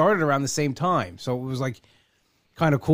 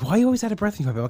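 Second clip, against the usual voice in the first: higher, fast mock-prying question; imitating the therapist; 6 semitones higher, much faster than usual. Imitating the therapist who keeps asking, fast higher mock question. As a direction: rattle off the nosy question in her voice. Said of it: "he's like".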